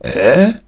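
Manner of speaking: "е" as the rounded French eu; French vowel sound. Shown as a demonstration. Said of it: "читається як".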